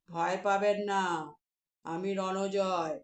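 low, slow, soothing giant voice; deep gentle giant. Acted. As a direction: reassure her slowly from deep down. Giant calms the mother.

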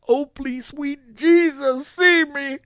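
high, loud frantic begging; desperate plea. A narrator's reading. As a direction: plead desperately to be seen. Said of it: "desperately bangs on the wall".

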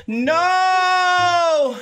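high, long drawn-out no; dismayed cry. Real, not acted. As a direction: wail it in dismay. Teleport loses the potion effect.

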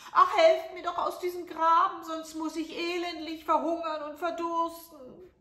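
put-on high, loud pleading old crone; old woman crying for help. A narrator's reading. Old woman in the ditch begs the prince, high and loud. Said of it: "Die rief ihm zu".

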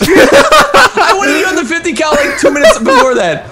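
loud, high laughing complaint. Real, not acted. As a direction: laugh while protesting. Laughing complaint after teammate died.

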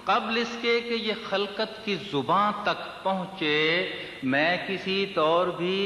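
measured mushaira declamation, words drawn out; lilting Urdu verse delivery; poetry recitation. Acted. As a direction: recite the couplet to a listening crowd.

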